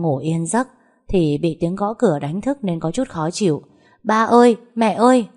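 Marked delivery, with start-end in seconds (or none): 4.0-5.3 high, louder call to parents. Acted. Daughter at her sleeping parents' door at night, calling out. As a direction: call out urgently, pitched up.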